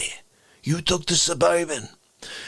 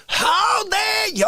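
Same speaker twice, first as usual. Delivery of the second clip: drawn-out, high-pitched indignation; hammed-up indignant cry; mock outrage; 12 semitones higher, slower than usual. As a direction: stretch it out, high and affronted. Mock outrage at someone in chat.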